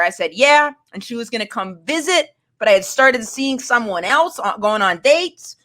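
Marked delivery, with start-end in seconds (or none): 0.3-0.7 eager yes. Retold or imitated. Agreeing to get back together.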